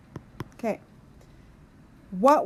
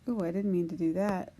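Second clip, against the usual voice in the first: ordinary talk, nothing marked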